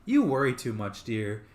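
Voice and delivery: normal talking voice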